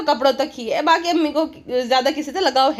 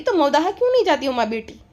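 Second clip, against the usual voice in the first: ordinary talk, nothing marked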